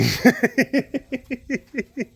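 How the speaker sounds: long, high staccato laugh; drawn-out comic high laugh; high laughing stutter. Acted. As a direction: break into a high, pulsing cackle. Drawn-out comic sound mid-quote.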